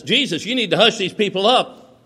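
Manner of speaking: high, loud scolding order; acted-out complaint, pushed up in pitch and volume; indignant demand. Pharisees demand Jesus silence crowd.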